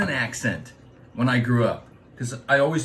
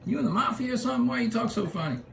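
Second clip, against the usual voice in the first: high, fast, quieter taunt; puzzled locals teasing the newcomer; mock Californian; 10 semitones higher, 5 dB quieter, faster than usual. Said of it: "people were like".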